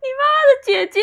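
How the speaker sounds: 高声惊讶地反问; 难以置信地拔高音调; astonished question. Retold or imitated. (听到阿姨是同一人时高声惊问)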